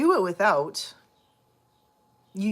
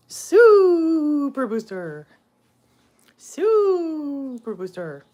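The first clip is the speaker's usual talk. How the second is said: slow, high, booming superhero announcement; hero announcer call; 8 semitones higher, 6 dB louder, much slower than usual. Pretend. Hailing the cat as a superhero, booming slow announcement. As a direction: stretch it out like a big hero reveal.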